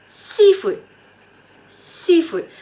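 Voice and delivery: SEA in "Seafood" punched high and loud; stress on SEA. Shown as a demonstration. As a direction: hit the first part of "Seafood", let the second drop. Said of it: "Put the stress on sea".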